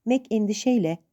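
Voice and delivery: ordinary delivery, nothing marked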